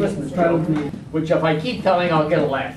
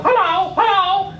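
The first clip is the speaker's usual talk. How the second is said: high, slow squawky parrot hello; 13 semitones higher than usual. Acted. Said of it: "And the parrot goes".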